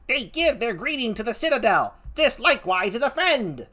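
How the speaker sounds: high, ringing announcement; excited stage herald. Acted. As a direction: announce the arrival across the stage. Acted announcement in the play.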